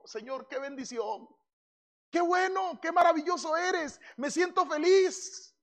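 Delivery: high, delighted little prayer; lifted, happy voice praising God; joyful prayer. Pretend. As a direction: sound thrilled and grateful. Preacher voices his own joyful prayer.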